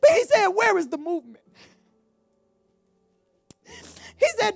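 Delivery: preaching through tears, voice catching; choked-up sermon; tearful preaching. Real, not acted. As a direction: keep the tears in the voice as you preach. Crying voice throughout.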